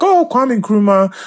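mock-thrilled stranger, pitch jumping up; excited recognition. Drivers' delighted reaction to Ghana.

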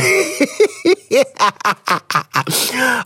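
long, high-pitched cackling laugh; real laughter. Real, not acted. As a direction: burst out laughing at your own advice. Laughs after advising to leave it.

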